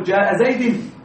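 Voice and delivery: زيد pronounced "Zaydin" as a wrong example; wrong genitive ending. Shown as a demonstration. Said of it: "لا تقل".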